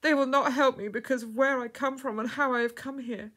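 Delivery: distressed cry. A narrator's reading. Outcast girl explaining to the woman, distressed cry. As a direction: protest in distress. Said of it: "cried the girl".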